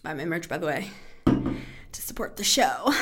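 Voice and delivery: quick whispered aside with a laugh; whispering. Real, not acted. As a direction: whisper it like a secret plug. Aside plug for merch.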